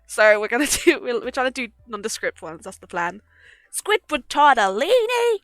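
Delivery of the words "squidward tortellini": excited shout. Real, not acted. Excited shout of pasta name.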